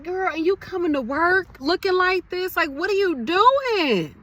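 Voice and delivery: put-on high, scolding "girl" voice to a sloppy coworker; mock scolding coworker. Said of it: "I'll be like".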